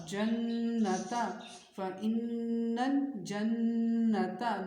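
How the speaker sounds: جنتا and فَإِنَّ الْجَنَّةَ with doubled n's held as nasal hums; slow جنتا, the n stretched through the nose; ghunnah on noon. Shown as a demonstration. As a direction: hum long on every doubled n in فَإِنَّ الْجَنَّةَ. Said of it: "غنہ کریں گے".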